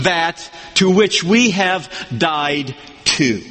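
slow, deliberate, word-by-word reading; measured emphatic recitation with pauses; literal rendering. Shown as a demonstration. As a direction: spell the sentence out slowly in weighty chunks. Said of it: "literally you'll hear it this way".

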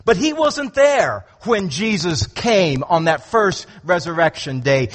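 usual speaking voice

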